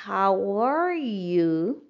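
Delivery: "How are you" drawn out very slowly, rising on "are"; slow model. Shown as a demonstration. Teacher repeats it slowly for learners to copy. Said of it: "Vamos mais uma vez".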